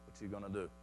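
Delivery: very soft, low challenge; hushed, unafraid retort; quiet defiance. Acted. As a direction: dare them in a hushed low voice. Bold answer to death threat.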